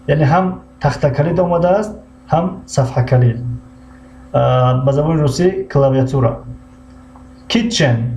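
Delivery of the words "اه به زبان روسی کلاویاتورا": usual speaking voice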